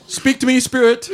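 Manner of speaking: booming high call to the spirit; loud summoning call. Acted. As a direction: call out to a ghost dramatically. Calling out to spirit.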